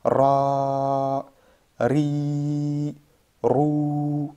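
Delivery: "Ra, ri, rou" each with a held vowel; long vowel drill. Shown as a demonstration. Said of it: "c'est le a qui se prolonge".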